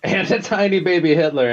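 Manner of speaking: echoing it with a laugh. Real, not acted. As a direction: repeat it, cracking up.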